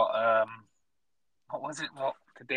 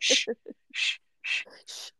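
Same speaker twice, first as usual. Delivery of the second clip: shushing whisper; much slower than usual. Retold or imitated. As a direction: hush him with a whisper. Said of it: "And he's just like".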